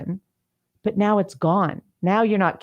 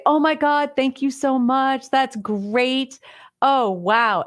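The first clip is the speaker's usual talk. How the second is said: high, bright, over-the-top gratitude; a daughter gushing to her mother over a vase; mock gushing thanks; 6 semitones higher, slower than usual. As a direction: pretend to love a gift you hate.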